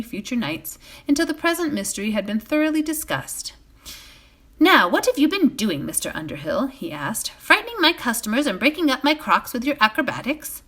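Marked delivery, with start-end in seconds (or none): 4.6-10.6 higher, louder scolding innkeeper; a mild telling-off from the landlord. A narrator's reading. Butterbur chiding Frodo over broken crocks, mild scolding.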